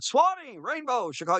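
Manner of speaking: high-pitched called-out requests, no real volume; mock audience yell. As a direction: imitate fans calling out song titles in a high voice. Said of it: "people are yelling out".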